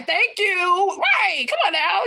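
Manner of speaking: high, exclaiming approval; emphatic agreement. Real, not acted. Thanking a viewer who backs her up, exclaiming. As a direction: cheer the point on, pitch way up. Agrees loudly with supportive comment.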